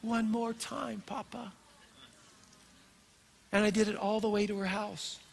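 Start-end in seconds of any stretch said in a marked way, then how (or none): 0.0-1.5 soft, slow tender plea; a granddad gently repeating the child's words; hushed child's plea. Retold or imitated. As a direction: whisper it like a sleepy toddler asking. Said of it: "and she says".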